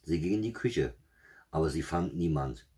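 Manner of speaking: ordinary delivery, nothing marked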